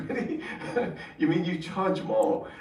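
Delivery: high, laughing question; amused half-laugh while asking; giggling surprise at the price; laughing disbelief. Retold or imitated.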